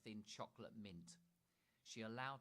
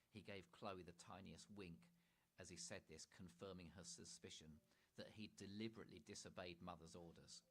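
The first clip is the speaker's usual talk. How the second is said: usual speaking voice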